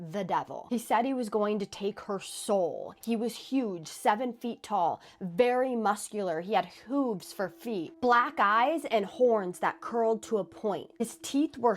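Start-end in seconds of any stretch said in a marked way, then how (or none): none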